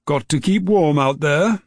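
called out after someone leaving; calling out. A narrator's reading. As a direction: raise the voice to reach a boy going out the door. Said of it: "Dad called out after him".

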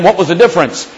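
normal talking voice

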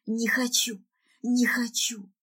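strained, husky cry without much volume; hoarse, desperate plea; hoarse yelling. Acted. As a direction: protest through a worn-out voice. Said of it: "охрипшим голосом ору".

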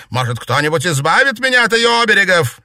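high, loud, fast yelling; furious scream. A narrator's reading. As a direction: keep shrieking in rage. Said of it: "крикнула она".

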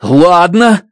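sharp angry outburst, high; shouting. Acted. Grace shouts back at Stratt, angry. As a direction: snap back in exasperation. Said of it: "закричал я".

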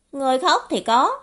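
snappy youthful retort; indignant retort. Acted. Cãi lại lời vừa nghe. (cãi lại, cao giọng)

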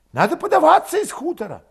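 high, loud urgent character voice; agitated villager. A narrator's reading. Urging his comrade to leave the village, agitated.

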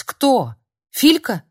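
loud, high panicked questions; alarmed cry. Acted. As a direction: fear for the boy. Yana alarmed.

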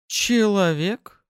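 drawn-out, high, disdainful question; contemptuous. A narrator's reading. Newcomer noticing a lowly human, contemptuous. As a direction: stretch the word slowly in high-pitched scorn. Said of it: "как-то презрительно произнесла".

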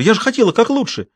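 high, hurt protest; taken aback. A narrator's reading. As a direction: defend yourself, stunned. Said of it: "оторопела Маша".

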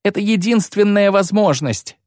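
high, insistent narrated outburst; agitated retort after cursing. A narrator's reading. Lyutik insists to the witcher it is the only chance, agitated. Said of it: "Лютик зверски выругался".

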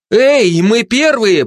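high, loud call to rivals; shouting. A narrator's reading. Mother runs for the bridge ahead of rivals, shouting. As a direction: yell it across at the competition. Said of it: "прокричала конкурентам мать".

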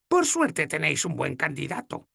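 voiced young heroine, gently teasing; Sefawin's voice. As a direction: play the tired, teasing young woman.

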